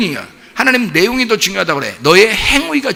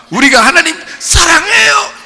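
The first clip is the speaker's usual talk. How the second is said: mock proud boast; 11 semitones higher, 4 dB louder than usual. Mocking believers' boast.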